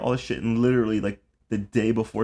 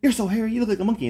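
put-on high whiny quoting of the boys' taunts; mocking bullied girl; 12 semitones higher, 3 dB louder, faster than usual. Caseworker imitating a client retelling childhood taunts, high and whiny. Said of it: "they'd be like".

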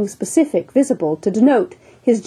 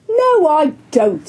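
slow, higher teasing denial; jovial captain; 8 semitones higher, 3 dB louder, slower than usual. Acted. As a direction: deny it with a laugh in the voice. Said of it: "said the captain".

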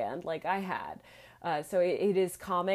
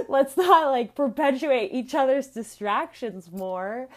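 emphatic excited; 5 semitones higher, 7 dB louder than usual. Real, not acted. Host rallying listeners.

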